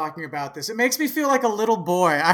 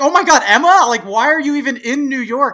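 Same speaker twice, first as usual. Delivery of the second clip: excited; 5 semitones higher, 8 dB louder, faster than usual. Retold or imitated. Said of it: "I was like".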